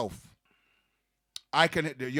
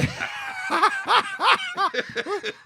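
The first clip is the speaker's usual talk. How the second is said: high, loud laughing shriek; 3 dB louder than usual. Real, not acted. Guest cracks up loudly at the host's joke. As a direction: burst into a screaming laugh.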